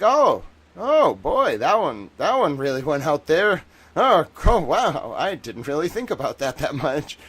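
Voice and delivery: high, loud swooping surprise; mock amazement. Streamer reacts to a post gone viral, mock amazement. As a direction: act out being bowled over, settling down toward the end.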